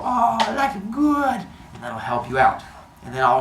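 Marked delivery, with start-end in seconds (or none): 0.0-1.5 high, slow dreamy contentment; mock blissful relief. Teacher acting an overwhelmed student soothed by the kitty, mock bliss. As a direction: coo it slowly as if soothed. Acts out relief at kitten picture.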